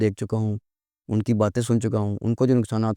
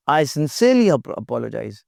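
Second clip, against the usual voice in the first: sincerely said slow and pressed, then apologize; earnest weight on sincerely; stress on sincerely; much slower than usual. Shown as a demonstration. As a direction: lean hard on sincerely. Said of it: "जोर दे के आप कहें जी".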